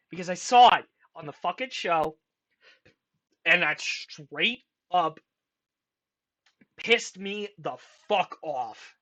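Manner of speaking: ordinary talk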